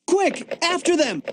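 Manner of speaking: high-pitched, urgent rallying cry; urgent call. Acted. Agent calls partner to chase the intruder, urgent. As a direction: spur your partner into a chase.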